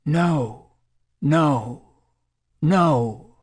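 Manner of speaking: an understated, narrated cry of distress; crying out, restrained. A narrator's reading. Distressed volunteer during a drug session, mildly crying out. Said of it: "For the next minute she cried".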